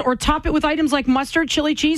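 high, bright commercial read. Acted. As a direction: read it like a TV ad announcer. Reads the product blurb aloud.

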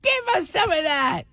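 high-pitched mock demand; jealous men calling out. Men calling to God for a share, mock demand. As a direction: call out in a high, put-on voice.

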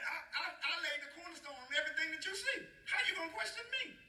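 high-pitched, incredulous challenge; hammed-up God rebuking a doubter; indignant God. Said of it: "God can talk like that".

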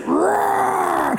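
high shouted roar, falling; big high-pitched mock roar; answering roar. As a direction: roar back to scare him in play. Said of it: "As soon as I said".

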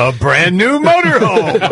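high, slow prize announcer; game show announcer. Pretend.

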